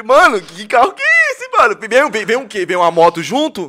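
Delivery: loud, high mock outrage; incredulous outburst. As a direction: protest the absurd price at full voice. Retold outrage at dealer's price.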